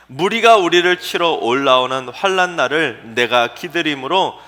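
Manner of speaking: slow, loud, drawn-out recital of the verse; solemn scripture reading. Acted. Preacher reading Habakkuk's cry to the congregation, slow and loud. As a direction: read the lament slowly and loudly.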